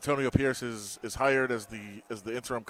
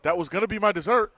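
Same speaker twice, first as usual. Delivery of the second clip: louder, higher mock outburst; retold indignant complaint; 7 semitones higher, 6 dB louder than usual. Coach complains his egg roll dessert is gone, mock indignant. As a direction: play up the coach's dismay over lost dessert.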